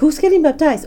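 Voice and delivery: high, loud eager ask; eager question. Retold or imitated. Daughter pressing missionaries about baptism, high and loud.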